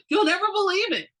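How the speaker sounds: high, loud burst of excitement; excited exclaim. Acted. Best friend bursting in with news, high excited exclaim. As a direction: blurt out the big news. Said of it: "Sandra exclaimed".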